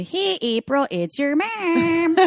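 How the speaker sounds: high, stretched Wisconsin mom voice; mom's Wisconsin accent. Retold or imitated. Mom calling her daughter on the phone, hammed-up Wisconsin accent. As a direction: imitate a Wisconsin mom, vowels dragged out. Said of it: "when my mom calls me".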